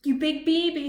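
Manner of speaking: mock the crybaby; teasing taunt. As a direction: Clunk jeering at Bimbot. Clunk teasing.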